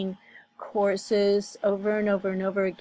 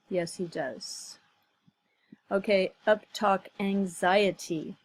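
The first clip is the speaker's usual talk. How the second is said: normal talking voice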